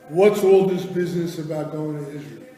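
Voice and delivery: loud, challenging relative; confronting. Retold or imitated. Family lines up the teenager to question him, loud confrontation. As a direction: demand an explanation, raising the voice.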